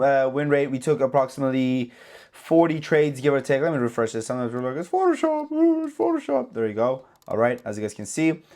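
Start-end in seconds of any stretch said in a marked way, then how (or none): 4.8-6.5 high, mocking whiny voice; squeaky put-on scoffing voice; mocking skeptic. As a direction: mimic a doubter sneering at fake gains. Mocking doubters' voice.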